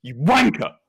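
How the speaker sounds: punchy British WANKER; mock British insult; British accent swearing. Shown as a demonstration. Brit demonstrates swear words for American, British accent swearing. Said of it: "British accents shouting British swear words".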